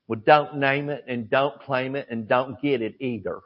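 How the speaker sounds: gentle Mississippi lilt, lightly imitated; a hint of a soft Southern Mississippi voice; soft Mississippi accent. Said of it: "with that soft Mississippi accent".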